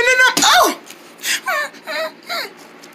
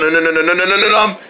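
ordinary delivery

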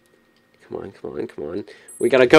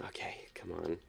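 whispering; 21 dB quieter than usual. Real, not acted. Tense sneaking past villain.

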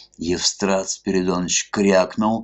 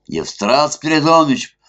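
high loud call across the hall; 10 semitones higher, 7 dB louder than usual. A narrator's reading. Club guests calling for the police chief, loud. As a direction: shout the name to the far rooms. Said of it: "понеслось по клубу".

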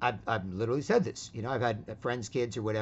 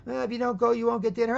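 high-pitched, exasperated warning; imitated nagging mom; 12 semitones higher, 7 dB louder than usual. Mom warns her boy about dinner, mock exasperated. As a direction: parody a mom threatening over the noise. Mother threatens whining child.

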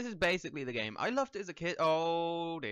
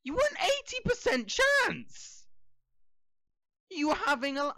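mock reproach; 13 semitones higher, 6 dB louder than usual. Scolding a patient who died.

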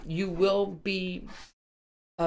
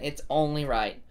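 ordinary delivery

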